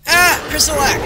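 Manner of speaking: high, loud cry of alarm; startled shout. Real, not acted. Player startled by a face in the game, alarmed shout. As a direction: shout it out as it jumps at you.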